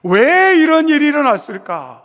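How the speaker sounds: loud pained outcry on 왜; anguished cry. Acted. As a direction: hurl the question upward in grief. Mary's anguished question, repeated.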